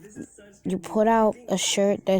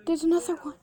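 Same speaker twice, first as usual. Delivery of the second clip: high-pitched alarmed disbelief; shocked disbelief; 10 semitones higher, faster than usual. Acted. Neil sees a second Max, shocked disbelief. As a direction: react aghast, pitch jumping way up.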